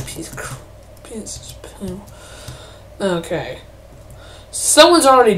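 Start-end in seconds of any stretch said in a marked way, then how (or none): 0.1-2.0 soft voiceless curse; whispered grumble. Real, not acted. Gamer cursing the game under his breath, whispered. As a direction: mutter it under your breath.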